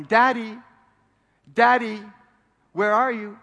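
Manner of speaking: put-on high, loud little girl calling for her daddy; child calling out. Five-year-old daughter calls for her hidden dad, high and loud. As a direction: call out like a small child searching.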